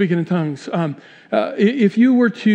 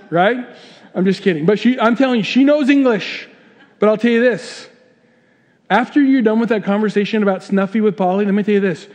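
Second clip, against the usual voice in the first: ordinary talk, nothing marked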